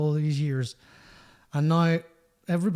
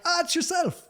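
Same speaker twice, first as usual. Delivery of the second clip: very high imitated Irish greeting; Tommy Tiernan; 14 semitones higher than usual. Comedian recognising musician in the bar, high imitated greeting. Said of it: "and he was like".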